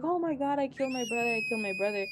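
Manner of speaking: high-pitched mock panic, easing down; freaking out. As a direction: imitate a little girl freaking out. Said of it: "She's freaking out".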